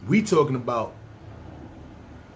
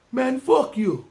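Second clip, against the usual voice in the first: put-on Caribbean accent; 10 semitones higher, slower than usual. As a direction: fling it in a put-on Jamaican accent. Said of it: "I don't know if it was a Jamaican accent".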